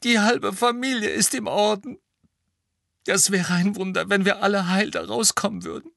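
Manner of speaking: high, loud, tearful outburst building; distraught, crying. A narrator's reading. Molly pouring out her fears for her family, sobbing. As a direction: let panic and tears spill over.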